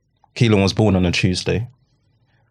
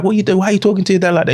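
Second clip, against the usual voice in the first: lightly voiced scolding mum, higher; mock telling-off, pitched up; imitating his mum; 10 semitones higher, much faster than usual. Grandmother scolding the son in the kitchen, higher mock voice. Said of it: "It's like".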